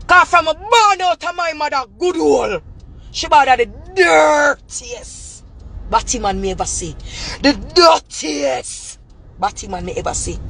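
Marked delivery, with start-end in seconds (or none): 3.1-5.4 slow, stretched jeering shout; slow taunting yell; drawn-out mocking shout. Real, not acted. Mocking rant at rival. 7.4-9.0 slow drawn-out jeer; slow stretched taunt. Real, not acted. Mocking rant at rival.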